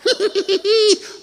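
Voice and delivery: high burst of laughter; real laughing. Real, not acted. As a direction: laugh at the joke. Teller laughs at own scene.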